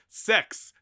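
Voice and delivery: high, loud one-word announcement; attention-grabbing shout. Acted. Reading Bart's class election sign, attention-grabbing shout. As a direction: blurt it out to grab attention.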